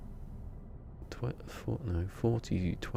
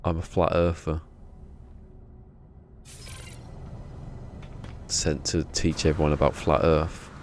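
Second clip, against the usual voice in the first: hammed-up flat earther in a weak American accent. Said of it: "I'm really bad at it".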